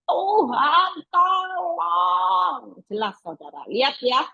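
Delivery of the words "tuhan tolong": crying out. Pretend. Preacher shows the earnest prayer of the desperate, crying out. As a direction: cry out to God with full force. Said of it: "segenap teriak".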